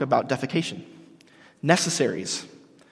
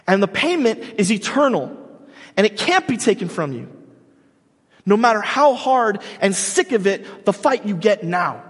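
louder, higher, driving home the promise; preaching at full force; 6 semitones higher, 3 dB louder than usual. Acted. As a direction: stretch out the payoff like a revival preacher.

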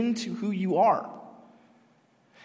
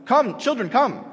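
raised, firm parental call; mom's command; 4 semitones higher, 4 dB louder than usual. Retold or imitated. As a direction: call the children over in short orders. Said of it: "what a mom would say".

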